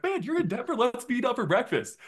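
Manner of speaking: very high-pitched, eager retelling; excited invitation. Retold or imitated. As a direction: act out a thrilled invite to a buddy. Retold eager invitation to meet up.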